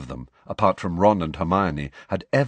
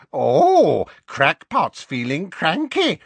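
much higher, slow sneering imp voice; mocking poltergeist; 8 semitones higher, 5 dB louder, slower than usual. A narrator's reading. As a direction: taunt him like a cackling spirit. Poltergeist taunting Harry.

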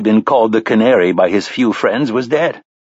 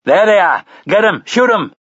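put-on rising high shout, Boston accent; rally the posse with a Boston yell; 10 semitones higher than usual. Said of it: "shouted the one with the Boston accent".

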